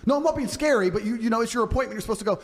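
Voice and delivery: hammed-up girlfriend in a fight; mock girl arguing. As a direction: play the girl turning it on him. Quarrel voiced in story.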